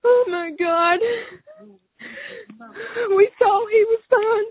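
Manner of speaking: high, loud anguished sob; crying out. Real, not acted. Mother crying out that they thought her son was fine. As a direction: wail the words in despair.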